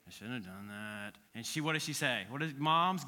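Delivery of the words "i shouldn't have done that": put-on a kid forced to apologize to sisters, sulky and low; sulky apology. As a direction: mumble it like an eye-rolling kid. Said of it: "world-class eye roller".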